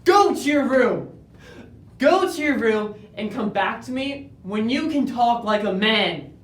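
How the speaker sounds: loud, high barked order; furious command. Retold or imitated. Father ordering his crying son to his room, shouting. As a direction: send him away in a rage. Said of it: "I would just end up saying things like".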